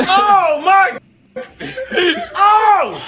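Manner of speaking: loud high laughing exclamations; excited. Real, not acted. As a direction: yell in shocked delight. Shocked at his reflection.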